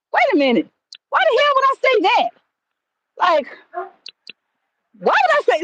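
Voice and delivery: pitched high, baffled retold thought; incredulous self-questioning. Man retelling his shock at his own blurted words, incredulous.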